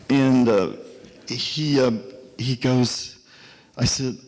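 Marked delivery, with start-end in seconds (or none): none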